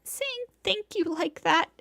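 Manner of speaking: put-on high, weeping girl's voice. Ashamed senpai weeping before Tono-kun. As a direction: say it while weeping. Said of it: "continues to weep".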